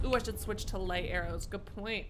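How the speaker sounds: ordinary delivery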